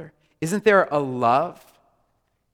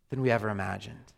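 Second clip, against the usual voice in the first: ordinary talk, nothing marked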